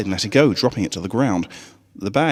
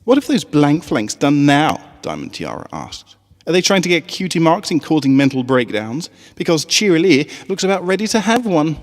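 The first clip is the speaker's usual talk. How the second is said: sneering filly; 8 semitones higher, 5 dB louder than usual. A narrator's reading. Snobby filly sneering at classmates.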